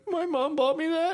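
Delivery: put-on high, slow childish squeal; mock tearful whine. A boy over his broken necklace, mock tearful whine. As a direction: imitate a kid welling up. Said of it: "I was a crier".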